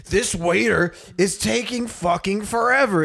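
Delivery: imitating a huffy diner; angry customer.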